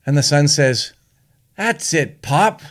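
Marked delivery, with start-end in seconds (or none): 1.6-2.6 high, emphatic son's punchline; raised voice landing hard on the swear; boy in a joke. Acted. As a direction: pipe up boldly as the kid, acted.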